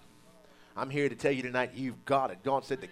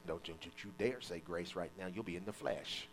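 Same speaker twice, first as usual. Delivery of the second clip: mock hushed warning; 10 semitones lower, 12 dB quieter than usual. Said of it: "Let's say grace".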